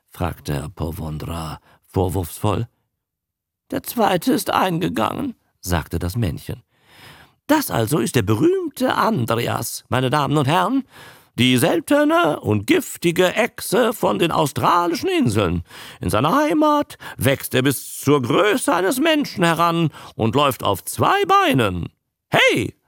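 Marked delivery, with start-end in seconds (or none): 3.7-5.3 offhand showman. Acted. Showman admits the loss. 7.5-22.7 a fairground barker hyping a single sick newt; showman's pitch. Acted. Showman presenting the creature.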